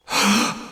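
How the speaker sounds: quick high intake of breath in shock; sharp gasp. Acted. Finding the bones in the burn, a sharp gasp. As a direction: gasp as if struck by the sight.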